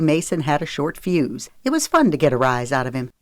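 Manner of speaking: ordinary delivery, nothing marked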